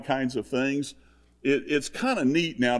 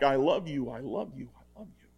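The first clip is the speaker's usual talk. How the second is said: imitated echo; 6 dB quieter than usual. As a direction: mimic an echo fading into a whisper. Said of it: "And it came back".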